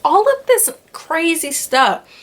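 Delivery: ordinary talk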